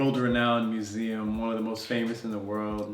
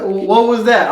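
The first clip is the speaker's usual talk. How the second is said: loud, high indignant shout; re-enacted outraged yell; retold yelling; 8 semitones higher, 13 dB louder, slower than usual. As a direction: yell after them in disbelief. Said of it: "I'm yelling at them".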